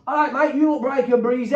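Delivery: chirpy mock greeting, possibly taking the piss; mock cheery manager. Manager's cheery greeting, maybe mocking.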